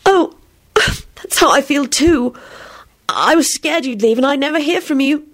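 put-on high tearful girl's voice; acted tearful confession, pitched high. A narrator's reading. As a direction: speak up high through welling tears. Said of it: "tears sprang up in her eyes".

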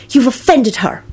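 high, put-on huffy voice; spiteful Luna. A narrator's reading. Luna defends her offended radish, spitefully. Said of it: "Luna replied spitefully".

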